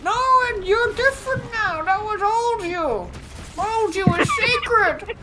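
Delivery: very high, loud silly voice; mock sacred-slot defender. Mocking the slot rule as a zealot, loud squeaky voice. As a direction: proclaim it slowly in a squeal. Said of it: "World just still was just like".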